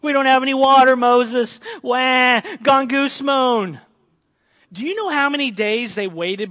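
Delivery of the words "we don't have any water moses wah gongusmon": loud, high mock whining at Moses; hammed-up childish wail; whiny Israelites. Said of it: "start mumbling and grumbling and complaining".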